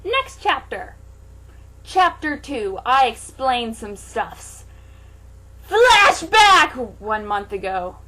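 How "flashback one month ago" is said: dramatic announcement. Acted.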